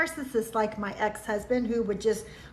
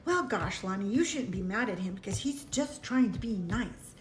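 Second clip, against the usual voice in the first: inner voice; 3 semitones lower, 6 dB quieter than usual. Retold or imitated. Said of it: "this is what I was thinking in my mind".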